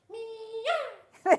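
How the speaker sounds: high, drawn-out mock meow; playful high meow; peacock meow. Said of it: "It's better as a peacock".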